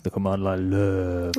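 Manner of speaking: low, slow drawn-out phrase; lightly voiced Simon impression; imitating Simon. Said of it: "as he would say it".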